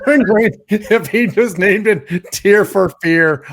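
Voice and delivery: laughing through the words. Real, not acted. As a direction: say it while cracking up. Laughing joke about band name.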